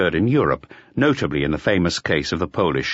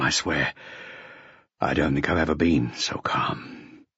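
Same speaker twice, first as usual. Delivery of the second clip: low, halting confession with long pauses; hushed, strained calm; 3 semitones lower than usual. A narrator's reading. Shaken inspector insists he is calm.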